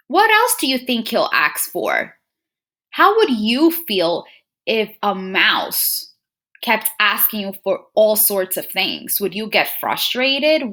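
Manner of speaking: ordinary delivery, nothing marked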